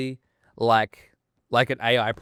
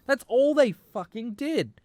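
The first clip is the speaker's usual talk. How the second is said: heated rant; 9 semitones higher, 4 dB louder than usual. Real, not acted. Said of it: "I'm getting heated".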